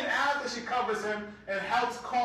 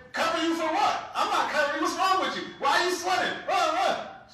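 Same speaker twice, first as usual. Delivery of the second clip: shouted, higher, exasperated nagging; mock nagging shout; 7 semitones higher, 4 dB louder than usual. Imagined wife scolds the sweating husband, mock shouting. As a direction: play the scolding wife, loud.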